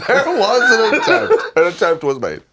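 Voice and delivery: loud, high voice breaking into laughter; laughing. Real, not acted. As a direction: tease through a big laugh. Laughing, teasing the failed attempt.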